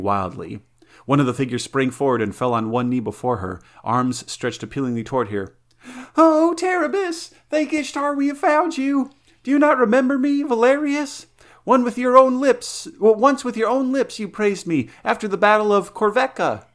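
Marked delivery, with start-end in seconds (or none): 6.2-16.6 high, loud pleading knight's voice; eager loyal soldier. Acted. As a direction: kneel and beg her to remember you.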